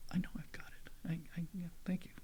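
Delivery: very soft, under the breath; muttered reply. Retold or imitated. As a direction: retell the comeback almost to yourself. Retold reply, now under his breath.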